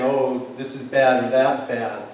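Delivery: ordinary delivery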